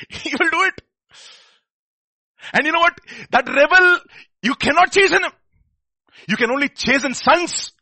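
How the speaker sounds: high, loud impassioned preaching; preaching at full force. Acted. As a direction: preach it with rising fire.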